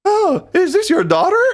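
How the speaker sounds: very high, slow mock question; hammed-up imitation of awkward small talk; playing the friend. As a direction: ask it in an exaggerated squeaky voice. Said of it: "I'm gonna be you".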